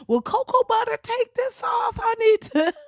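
high, whiny pleading voice; mock desperate plea. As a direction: beg like he's panicking over his nose.

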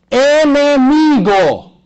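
enemigo drawn out very slowly and high; stress on enemigo. Acted. Preacher stressing the key word.

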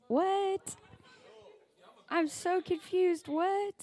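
high, puzzled mock student; confused teen voice. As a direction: play a baffled teenager.

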